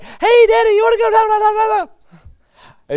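put-on very high, loud child's voice; excited high boy voice; little boy voice. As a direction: squeal it like an eager little kid. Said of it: "I came in one day and Jay".

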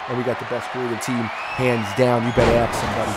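low, soft throwaway boast; quiet aside. Real, not acted. Commentator boasting of the creative team, quiet aside. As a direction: drop to a low murmur.

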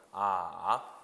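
"아" dipping low, then rising; third tone. Shown as a demonstration. Teacher modelling the third tone, low dip then rise. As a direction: scoop "아" down and swing it back up. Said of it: "그렇게 아무도 말을 안 할 겁니다".

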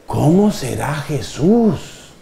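put-on slow, hushed child-like wondering; acted wonder. Child wondering about Jesus after seeing dad kneel, awed. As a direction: draw the question out, lightly awestruck.

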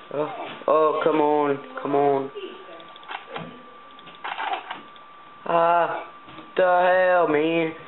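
high, exasperated gamer protest; exasperated outcry. Real, not acted. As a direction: cry out in frustration as the game beats you. Shut out, attacked in game.

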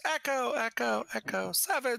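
playful pretend radio echo; mock radio echo. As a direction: fake a radio call bouncing back, echo echo. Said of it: "when he calls out Echo Seven".

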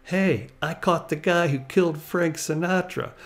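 excited mock yell back to the car; retold yell. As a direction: call it out across to the partner, half mock. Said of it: "he yells back to his partner".

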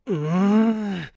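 slow drawn-out growl; low growl. Acted. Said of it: "一声うなって".

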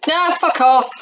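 loud, dismissive angry shout. Real, not acted. Gamer yelling at the game, furious shout. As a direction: bark it at the screen.

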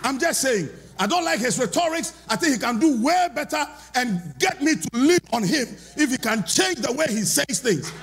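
high, loud, rousing preacher; rally the church, shouting it out; preaching at full force. Acted. Preaching to congregation.

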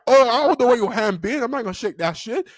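high-pitched retold protest; mock indignant refusal. Student refusing teacher's handshake, imitated high protest.

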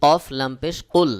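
কুল with a hard, pressed ক; emphatic qaf in কুল; firm qaf sound. Shown as a demonstration. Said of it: "কফের উচ্চারণ একটু শক্ত করে চাপ দিয়ে উচ্চারণ করতে হবে".

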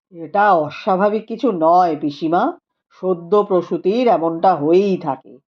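a calm scholar speaking gravely; Rupendra's voice. A narrator's reading. (নিচু গম্ভীর গলায় পণ্ডিত রূপেন্দ্র)

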